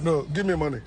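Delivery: the pretender's true voice, hushed and low; mocking aside. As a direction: drop to a greedy mutter. Pretenders' real aim, mocked.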